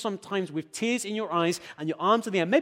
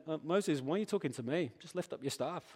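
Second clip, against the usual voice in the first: a hushed, offhand God voice, much softer; quiet God voice; 2 semitones lower, 10 dB quieter than usual. Retold or imitated. God answering Moses in a soft, dry hush. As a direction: quietly ask Moses why he's talking instead of acting. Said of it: "God says to him".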